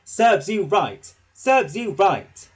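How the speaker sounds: loud, slow, puffing rebuke; cross. A narrator's reading. Thomas crossly puffing at the naughty trucks. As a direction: puff it out crossly. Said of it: "puffed Thomas crossly".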